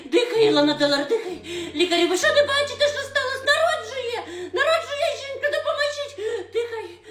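put-on high panicked old woman calling doctors; panicked granny shouting. (висока, панічна бабуся кличе лікарів)

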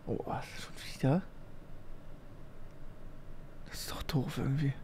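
very soft, dismayed mumble; deflated muttering. Real, not acted. Reactor dismayed by the video, deflated muttering. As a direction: say it under your breath, let down.

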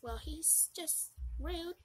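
ordinary talk, nothing marked

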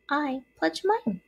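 high, soft spider voice; little spider. A narrator's reading. Joy pledges friendship to Wilbur, small voice.

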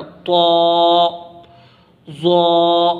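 طا said thick and full, ظا heavy too. Shown as a demonstration. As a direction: make طا heavy, not like تا. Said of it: "اس کو موٹا پڑھنا ہے".

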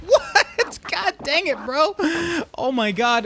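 high loud laughing exclaim; exclaim, half laughing; surprised exclaim. Real, not acted. Game mishap, laughing.

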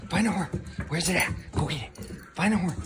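high sing-song dog coaxing; high-pitched urging. Real, not acted.

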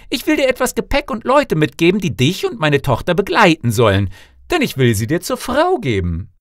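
loud, high-pitched call from the king. A narrator's reading. King calls to the suitor about to leave, loud high call. Rief der König ihm zu.

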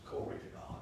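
breathy whispered glory to God; whispered praise. Shown as a demonstration. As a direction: let it fade to a whisper. Repeated, fading praise.

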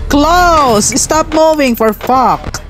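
high-pitched exasperated yell; real exasperation. Real, not acted. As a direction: yell at a game that won't obey. Frustrated at the game's controls.

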